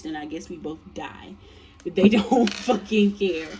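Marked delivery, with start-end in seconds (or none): 1.8-3.5 slow words broken by laughter; amused, slowed laughing delivery. Real, not acted. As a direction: laugh your way through it. Laughs through the line.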